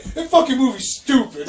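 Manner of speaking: hammed-up offended rant; mock angry. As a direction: spit it out disgusted. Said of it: "he was like".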